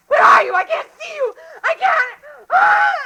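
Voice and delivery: high, loud crying shout ending in a scream; screaming in terror. Acted. Woman pleading with Tom in the dark, crying out in terror. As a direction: beg into the dark, then scream.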